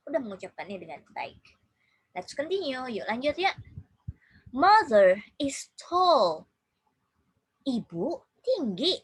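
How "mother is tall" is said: slow, loud model sentence; teacher reading very slowly and loudly. Shown as a demonstration. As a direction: draw each word out for learners to copy. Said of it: "Please repeat after me".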